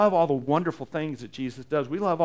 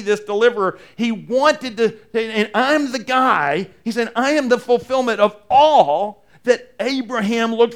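rising, louder preaching build; full-force preaching; 6 semitones higher, 6 dB louder than usual. Acted. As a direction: drive it home from the pulpit. Preaching about Abraham's faith.

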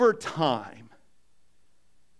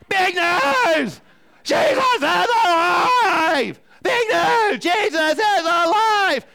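full-out high shout, long drawn words; imitated screaming; 13 semitones higher, 8 dB louder, much slower than usual. Grandkids hanging on car window, screaming to the world. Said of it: "screaming out".

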